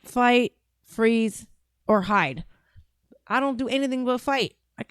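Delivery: usual speaking voice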